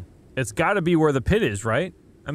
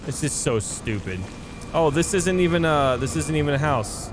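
ordinary talk, nothing marked

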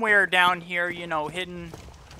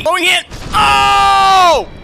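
high shrieking panic; startled shriek; 12 semitones higher, 10 dB louder, much slower than usual. Real, not acted. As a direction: scream in sudden fright. Sudden scare in game.

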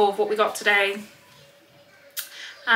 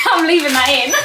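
laughing; 5 semitones higher, 5 dB louder than usual. Real, not acted. Laughs at her own blooper.

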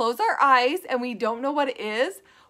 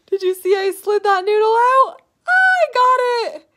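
excited; 7 semitones higher, 6 dB louder than usual. Real, not acted. Excited at pulled noodle.

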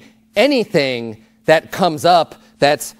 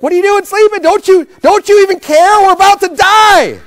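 high, loud panicked cry; panicked disciples; 13 semitones higher, 8 dB louder than usual. Retold or imitated. Frightened disciples crying out to sleeping Jesus, panicked. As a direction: yell it like frightened fishermen in a storm. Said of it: "It sounds like they're a little afraid".

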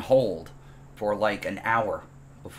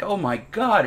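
dismayed realization; 10 semitones higher, 4 dB louder, slower than usual. Retold or imitated. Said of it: "and I'm like".

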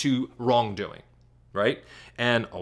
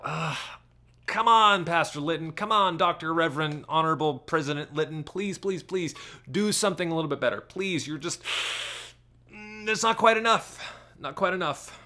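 mock-exasperated plea, sighing please; exasperated pleading; 4 semitones higher than usual. Church member pleading with Pastor Litton, exasperated and whiny. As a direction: beg him with weary disappointment.

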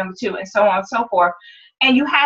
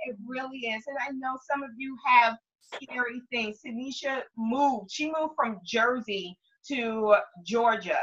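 soft, serious; 10 dB quieter than usual. Real, not acted. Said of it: "very scary thing".

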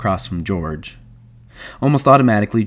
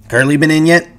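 offhand question, pitched up; casual; 7 semitones higher, 4 dB louder than usual. Acted. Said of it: "Carlson said casually".

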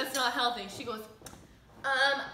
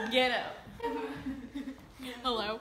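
ordinary talk, nothing marked

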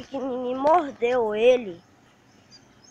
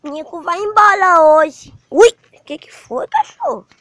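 excited pet talk; 6 semitones higher, 9 dB louder than usual. Real, not acted. Excited cooing at the dog.

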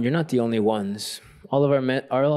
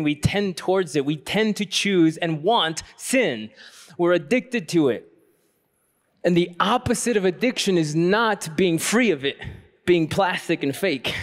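fervent preaching; 5 semitones higher than usual. Acted. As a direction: preach it home to the congregation. Homily builds to full preaching.

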